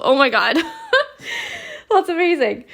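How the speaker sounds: giddy laugh into a high exclamation; overjoyed laughing. Real, not acted. Reacting to the visa email on her livestream, overjoyed laughing. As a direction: laugh with real delight, voice jumping up.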